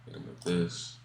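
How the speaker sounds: under-breath whisper to self. Real, not acted. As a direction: breathe it out, barely voiced. Muttering code to self.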